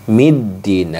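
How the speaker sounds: "middin" with the doubled d pressed hard; stressed doubled d. Shown as a demonstration. Teacher showing the pressed tasydid on "middin". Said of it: "maka ditekan".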